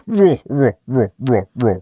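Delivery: short, repeated mock roars; imitated giant's roaring; monster roar. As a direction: roar in a string of short bursts. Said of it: "you can hear".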